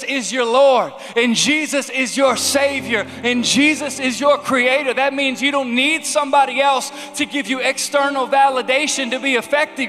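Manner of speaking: rousing preaching. Acted. Pastor stirring the crowd to excitement, preaching loud. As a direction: stir them up, building.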